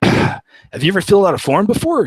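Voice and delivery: high, mocking imitation of a snarky guy. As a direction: sneer it like the condescending border helper. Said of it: "is real snarky".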